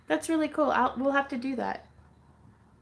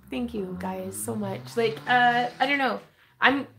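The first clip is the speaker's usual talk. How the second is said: ordinary talk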